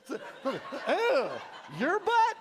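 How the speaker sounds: high, loud disgusted squeal with a laugh; grossed-out squeal. Acted. Mock reaction to gross story.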